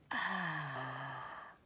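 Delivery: very soft low sigh; baby settling to sleep; contented sigh. Acted.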